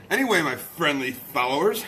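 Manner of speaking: normal talking voice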